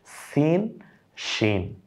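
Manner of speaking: সিন with a plain s sound, then শিন with a soft sh sound; pronunciation demo. Shown as a demonstration. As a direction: contrast সিন and শিন clearly. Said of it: "দেখুন উচ্চারণটা একটু". (সিন আর শিন আলাদা করে উচ্চারণ)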